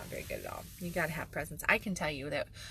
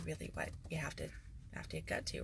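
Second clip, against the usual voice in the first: much softer, almost breathed; quiet trailing aside; 3 semitones lower, 10 dB quieter, faster than usual. Real, not acted. Speaker to the audience, softly stressing the point. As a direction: drop away to a hush. Drops to a hush.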